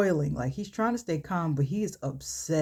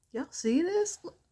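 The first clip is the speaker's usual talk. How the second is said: excited; 12 semitones higher than usual. Real, not acted. Sharing her makeup with viewers, high thrilled call.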